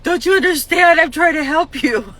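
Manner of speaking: loud, high exasperated plea; pushy and loud. Retold or imitated. Pushy helper snapping at people, loud and high. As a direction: force your help on them. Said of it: "I get pushy and loud and mean".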